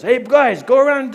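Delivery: loud, high-pitched holler; calling out. Retold or imitated. Rescuer directing his friends, hollered out. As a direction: shout orders across to the crew.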